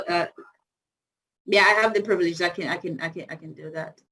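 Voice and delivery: usual speaking voice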